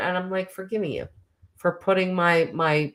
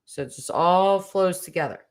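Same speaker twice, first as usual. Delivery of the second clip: ordinary talk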